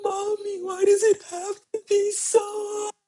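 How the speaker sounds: put-on high, teary child's wail; crying daughter. Mother imitating her daughter crying about life being hard, high and teary. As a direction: wail it like a sobbing little girl.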